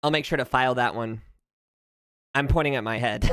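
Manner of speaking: usual speaking voice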